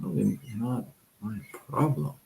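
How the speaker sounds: softly shrugging off someone's trouble; a quiet, mock-indifferent brush-off; dismissive brush-off. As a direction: wave the problem away under your breath. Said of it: "simply say".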